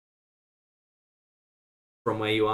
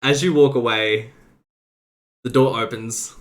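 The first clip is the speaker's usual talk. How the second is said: normal talking voice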